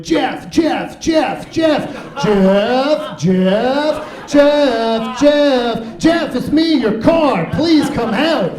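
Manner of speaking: high, loud, frantic yelling for Jeff; pleading car alarm. Acted. Car alarm shouting for its owner during a break-in. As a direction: cry out like a panicking car begging for help. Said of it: "your car just starts going".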